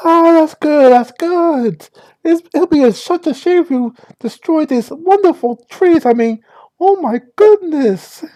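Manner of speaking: put-on high, gushing Valley Girl; bubbly sorority girl chatter. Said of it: "trying to use part girl".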